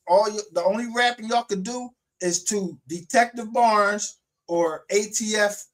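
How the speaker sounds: loud, high-pitched, drawn-out taunting; real angry rant. Real, not acted. Host calling out rappers who can't rap, angry taunt. Ranting at rappers, mocking them.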